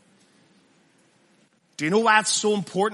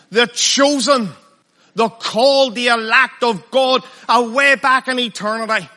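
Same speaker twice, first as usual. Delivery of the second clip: loud, rising pulpit thunder, hammering each phrase; fiery preaching; 4 semitones higher, 7 dB louder than usual. Acted. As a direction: preach it hard, building to a roar on the elect of God. Preaching builds to full force.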